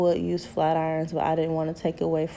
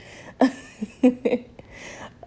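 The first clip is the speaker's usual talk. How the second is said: breathy laugh; 7 semitones higher than usual. Real, not acted. Laughing at herself bouncing.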